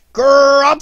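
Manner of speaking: huge roaring cough noise; high, very loud spluttering blast; explosive cough. Acted. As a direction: let out a bouncy, explosive bark. Tigger demonstrates his explosive cough.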